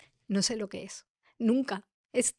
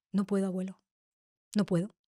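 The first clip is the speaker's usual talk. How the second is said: llorosa; 4 dB quieter than usual. Retold or imitated. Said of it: "Lloraba, lloraba".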